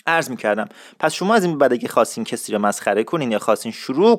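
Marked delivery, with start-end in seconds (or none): none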